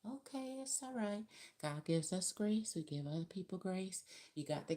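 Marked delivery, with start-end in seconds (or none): 0.1-1.2 soft, high and soothing; real gentle. Shown as a demonstration. Showing viewers what grace sounds like, soft and gentle. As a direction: ease them gently, like calming a child. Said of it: "Grace is, like, real gentle".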